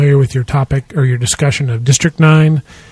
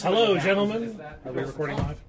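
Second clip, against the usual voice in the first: smarmy voice; 13 dB quieter, faster than usual. Pretend. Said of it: "Smarmy Voice".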